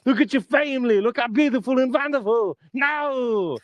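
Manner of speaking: hammed-up muscleman berating a hopeless fan; Arnold Schwarzenegger. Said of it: "And he will say".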